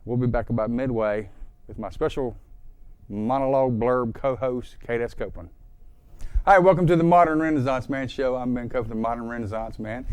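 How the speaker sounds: ordinary talk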